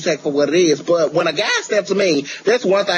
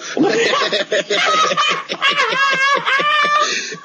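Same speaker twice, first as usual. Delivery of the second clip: high shrieking laugh. Real, not acted. Huge laugh.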